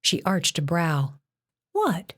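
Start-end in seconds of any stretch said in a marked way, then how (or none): 1.7-2.1 sharp high startled what; surprised. A narrator's reading. Jen reacts to the god's words, high and surprised. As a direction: snap it out in disbelief. Jen shocked at his remark.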